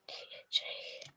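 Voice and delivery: whispered letters, barely there; murmuring to self. Real, not acted. Crafter counting off letters to herself, whispered. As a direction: spell it out under your breath.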